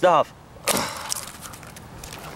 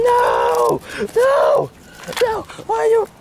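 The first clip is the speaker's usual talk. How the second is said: upset shouting; slower than usual. Acted. Puppet's thing is being destroyed.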